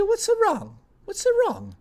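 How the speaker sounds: very high, thin old-lady voice, lightly mocking; nana's voice. Imitating his nana asking his crying dad, in a very high old-lady voice. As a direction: imitate grandma asking in a tiny high squeak. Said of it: "she literally talked like that".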